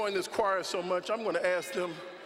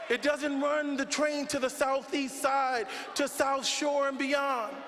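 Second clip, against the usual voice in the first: preaching at full force; 4 semitones higher than usual. Real, not acted. Preacher rousing the congregation about transit racism, at full force. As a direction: drive it home like a preacher on a roll.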